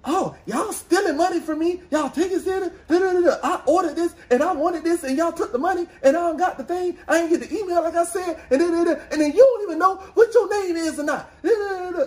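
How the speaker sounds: high, fast mock rant of an angry caller; angry customer. Retelling a call, mimicking the angry customer, high and fast.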